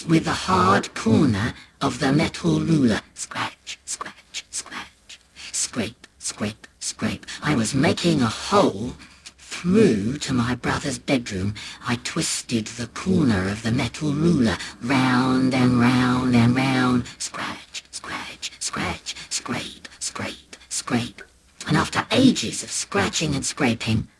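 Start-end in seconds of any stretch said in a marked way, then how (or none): 3.1-7.3 whispered, rhythmic scrape; secretive, very soft chant; hushed scratching sounds. A narrator's reading. Imitates secret scraping at the wall. 17.2-21.2 whispered, rhythmic scrape; secretive, very soft chant; hushed scratching sounds. A narrator's reading. Imitates secret scraping at the wall.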